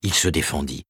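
ordinary delivery, nothing marked

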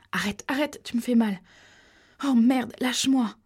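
high, urgent cries to stop; pained protest. Acted. Pregnant girl grabbed by the man, crying out in pain. Struggling, being hurt.

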